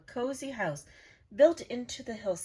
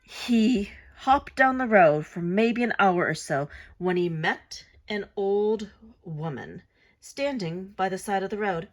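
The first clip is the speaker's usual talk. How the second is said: ordinary delivery, nothing marked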